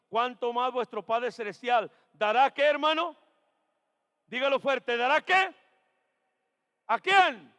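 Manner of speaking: loud, rising calls to the congregation; preaching call-and-response. Acted. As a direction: pull the answer out of the church.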